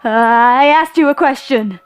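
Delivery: dumbfounded demand. A narrator's reading. Confused man challenges the unknown voice, dumbly. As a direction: stretch the start and push it out. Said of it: "he said dumbly".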